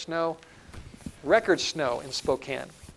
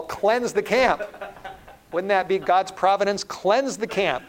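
hammed-up righteous cry; mock zealot; 5 semitones higher, 5 dB louder than usual. Zealot urges God's cleansing of Babylon, loud mock fervour. Voices imagined zealot urging destruction.

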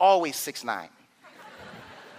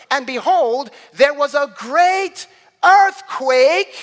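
forceful preaching; 6 semitones higher, 6 dB louder than usual. Real, not acted. Preacher reading the resurrection to the congregation, forceful preaching. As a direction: proclaim it, stretching the big moments.

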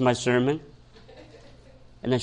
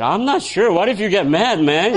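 high, louder comic hesitation; hammed-up wary protest; mock worry; 6 semitones higher, 4 dB louder than usual. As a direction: balk nervously with the pitch jumping up. Comic worried reply to her request.

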